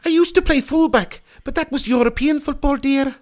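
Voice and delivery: too-high granny voice; Mrs Doubtfire. Acted. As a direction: lay it on as an old nanny, pitched far up. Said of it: "That's not how Missus Doubtfire sounds".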